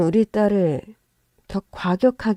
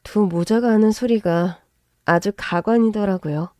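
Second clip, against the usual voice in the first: usual speaking voice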